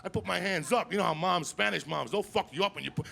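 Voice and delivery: normal talking voice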